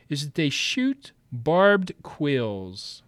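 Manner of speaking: slow, clear dictating; slow dictation. Shown as a demonstration. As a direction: spell it out slowly for the class. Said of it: "I want you to add to your list".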